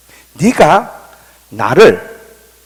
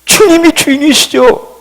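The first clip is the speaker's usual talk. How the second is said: high, drawn-out, assured reply; reenacted self-assured declaration; confident answer; 11 semitones higher, 4 dB louder than usual. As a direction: answer boldly, pitched well up. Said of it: "자신 있게".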